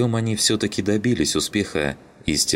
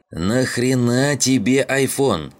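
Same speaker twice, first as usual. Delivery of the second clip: loud, high, slow outburst; exasperated friend yells at the iPhone; exclaiming; 8 semitones higher, 4 dB louder, slower than usual. A narrator's reading. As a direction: demand it in disbelief. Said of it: "воскликнул он".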